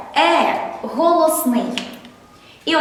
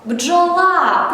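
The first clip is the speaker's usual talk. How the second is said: «Бджола» with a raised, drawn-out ла; correct final-syllable stress; 5 semitones higher than usual. Shown as a demonstration. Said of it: "Правильно".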